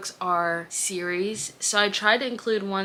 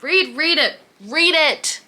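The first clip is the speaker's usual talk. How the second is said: urgent plea; 8 semitones higher, 7 dB louder, slower than usual. Real, not acted. Urging viewers to read.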